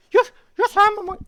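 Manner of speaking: loud, very high monkey squeal; attempted monkey voice. Reluctant reader tries the book's monkey voice, high squealed attempt. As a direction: try a squeaky monkey and give up. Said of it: "Do I have to do a monkey voice?".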